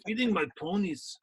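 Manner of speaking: high, put-on Austrian accent that slides off; hammed-up Austrian accent, not quite landing; bad Schwarzenegger accent. Cohost hamming up Schwarzenegger's Austrian accent, badly. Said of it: "that wasn't very good".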